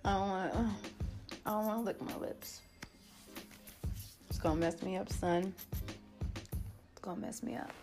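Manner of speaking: ordinary delivery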